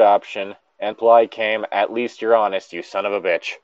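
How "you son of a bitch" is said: ordinary talk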